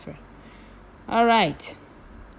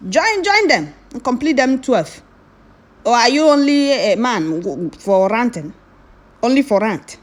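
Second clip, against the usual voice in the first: angry rant; 2 semitones higher, 5 dB louder than usual. Real, not acted. Ranting loudly at commenters who mocked eleven men. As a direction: blast the doubters with rising fury.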